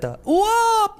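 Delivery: mock whoop. Mock commercial jump cry.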